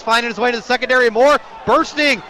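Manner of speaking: ordinary delivery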